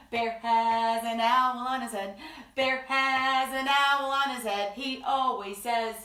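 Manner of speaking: ordinary talk, nothing marked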